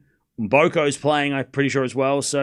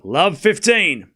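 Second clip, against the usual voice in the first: slow drawn-out score call; much slower than usual. Acted. Calling the score again, drawn out.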